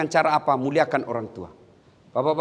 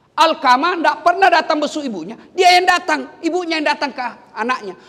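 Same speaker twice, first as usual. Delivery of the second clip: high, raised-voice sermon storytelling; forceful preaching; 11 semitones higher, 5 dB louder than usual. Real, not acted. Preacher explaining Alqamah's sin against his mother, high and emphatic. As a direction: press the question, then answer it emphatically.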